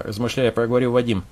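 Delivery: plain reading voice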